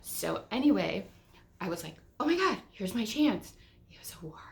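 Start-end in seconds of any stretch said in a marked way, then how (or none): 2.2-3.5 high, loud burst of eagerness; excited exclaim. Retold or imitated. To herself at the paint counter, eager and high. 3.9-4.4 breathy confiding whisper on "It was a war."; whispered aside. Real, not acted. To viewers, an aside whispered as a secret.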